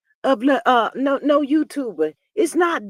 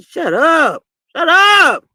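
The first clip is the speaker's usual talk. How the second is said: mock snapped shut up; 4 semitones higher, 6 dB louder, much slower than usual. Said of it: "Marcel looked at her like".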